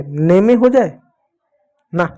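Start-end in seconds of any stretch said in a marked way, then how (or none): 0.0-0.5 नेमे with the final e sounded as "ay"; wrong reading. Shown as a demonstration. Teacher asking whether e is read, नेमे with wrong ay ending. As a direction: say नेमे, two syllables, ending in ay. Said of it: "ए की मात्रा लगा दें".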